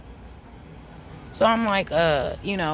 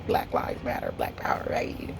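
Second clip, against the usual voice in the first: hushed whispered aside, much softer; 6 dB quieter than usual. Real, not acted. To the audience about the driver, whispered aside. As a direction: slip it in under your breath.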